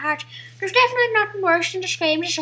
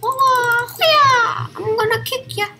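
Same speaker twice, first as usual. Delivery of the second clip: hammed-up taunt, pushed high and louder; high-pitched battle taunt; 10 semitones higher, 7 dB louder than usual. Gamer threatening to kick the enemy, high hammed-up taunt. Mock battle cry while kicking enemies.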